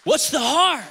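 high, loud challenge; forceful preaching. Real, not acted. Preacher challenges fasting congregation on motive, forceful. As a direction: hit the question hard and hold HEART. Preacher hammers key question.

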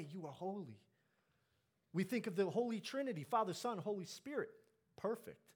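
full-force preaching. Real, not acted. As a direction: proclaim it with rising fervor to the congregation.

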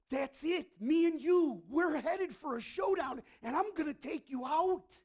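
loud, high, fighting words to death; defiant challenge. Retold or imitated. Jesus daring death to a fight, loud and defiant. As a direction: call death out for a showdown.

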